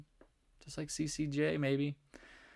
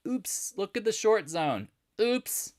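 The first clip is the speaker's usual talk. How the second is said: high, slow singsong oops; feigned surprise, pitched way up; mock oops; 10 semitones higher, slower than usual. Trader pointing out the short zone, mock surprise.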